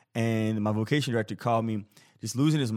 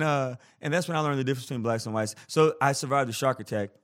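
usual speaking voice

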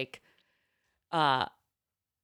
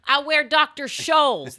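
mock excuse; 6 semitones higher, 7 dB louder, slower than usual. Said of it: "That's what I said".

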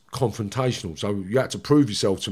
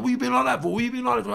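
retold screaming match; 13 semitones higher, faster than usual. Other inmate protesting in the argument, high retold complaint. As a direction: imitate his aggrieved comeback up high. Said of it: "a bit of a screaming match".

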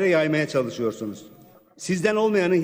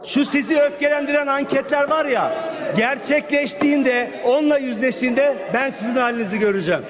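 angry; 7 semitones higher than usual. Real, not acted. Defiant warning to ruling party.

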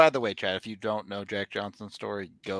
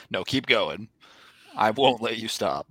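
loud mock coach; 8 dB louder than usual. Mock coach voice, teasing bit.

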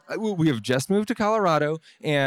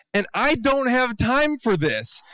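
exasperated shout; 11 semitones higher, slower than usual. Real, not acted. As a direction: yell it out, fed up and panicking. Sprinting through bees, exasperated.

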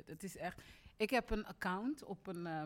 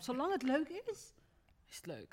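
breezy catchphrase; 5 semitones higher than usual. Retold or imitated. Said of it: "Ik zeg altijd".

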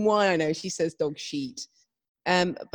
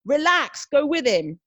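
raised, higher-pitched coaching call; retold shout; 7 semitones higher, 3 dB louder, slower than usual. Riding instructor calling out to her pupil, mock shout. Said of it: "her just shouting".

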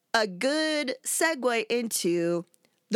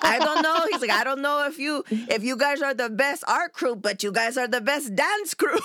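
put-on terrible Italian accent; 3 semitones higher than usual. Friend quoting the Italian artist's praise, in a terrible Italian accent. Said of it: "I'm gonna do a terrible Italian accent".

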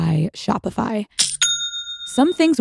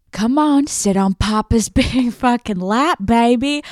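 higher, laughing hammed-up daddy voice; mock Papa voice; 3 semitones higher than usual. Host inviting listeners onto Papa's lap, in a laughing, hammed-up daddy voice. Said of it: "let's sit down on Papa's lap".

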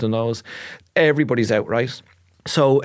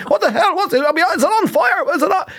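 very high, frantic repeated what the hell; dad panicking in circles. Retold or imitated. Dad running round the garden in circles, frantic high yelling. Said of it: "He kept running out of the house".

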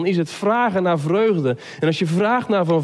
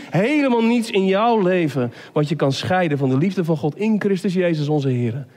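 normal talking voice

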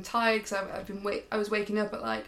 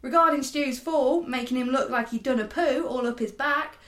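badly faked Kiwi accent sliding into cockney; New Zealand accent gone cockney; 2 semitones higher, 4 dB louder than usual. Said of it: "I'm going proper cockney now".

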